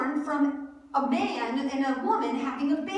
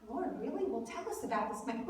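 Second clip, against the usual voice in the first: hushed, much softer pretend question; mock attentive; 3 semitones lower, 10 dB quieter, faster than usual. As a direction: play a politely interested disciple, very softly. The reply Peter did not give.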